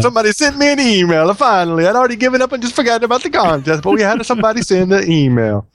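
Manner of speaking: high, excited mock-Italian voice; mock Italian accent. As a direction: exult in a singsong fake Italian accent. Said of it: "I did it with an Italian accent".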